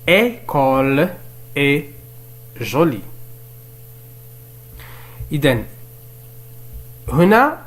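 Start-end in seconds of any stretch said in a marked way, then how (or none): 0.1-3.0 École, est, jolie, each set apart by pauses; very slow, leave a gap after each word; word-by-word reading. Shown as a demonstration. Teacher repeating the exercise for learners, word-by-word reading.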